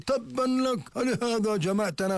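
scornful retort. Retold or imitated. Quraysh rebuke the Prophet, high mock-angry retort. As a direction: spit the rebuke back in mock contempt. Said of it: "قالت قريش".

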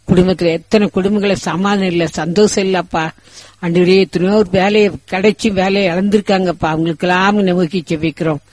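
steady, pleading prayer voice to God; fervent prayer. Acted. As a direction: pray earnestly over the whole congregation. Pastor leads long congregational prayer.

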